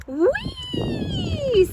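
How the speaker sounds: very high gleeful whee; squeal of delight. A narrator's reading. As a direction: squeal like a kid on a ride. Baby delighted.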